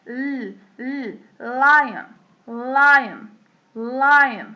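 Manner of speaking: held "ال", then drawn-out "lion"; tongue-held L. Shown as a demonstration. Teacher demonstrating L for learners, held L and stretched "lion". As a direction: hang the tongue on L, leading into "lion". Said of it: "لساننا متعلق فيها".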